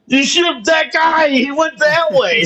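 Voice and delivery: high, slow put-on bystander voice; mock innocent bystander. Telling the cops, slow and high pitched. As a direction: play a clueless guy on the street. Retelling pointing cops away.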